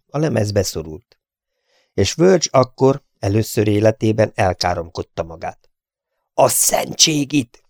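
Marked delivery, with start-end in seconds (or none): 6.3-7.6 slow, raised curse; first curse. A narrator's reading. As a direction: spit out the oath. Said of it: "kiáltotta".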